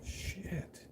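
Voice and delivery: breathy whispered dread; retold whispered dismay; whispered curse. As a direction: whisper it as the bad news lands. Reaction to the lawyer's warning.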